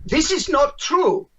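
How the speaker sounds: objecting in a mock German accent. German man in the audience objects to the speaker, in a German accent. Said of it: "excuse my German accent".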